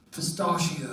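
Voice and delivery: hushed and pinched, mouth made tiny; small tight voice. Retold or imitated. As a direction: barely open lips, soft and prim. Posh gelato order, tiny mouth.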